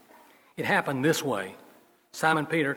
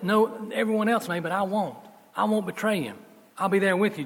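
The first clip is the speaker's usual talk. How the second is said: Peter's bold vow; 6 semitones higher than usual. Acted. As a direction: swear loyalty boldly. Peter's vow voiced by preacher.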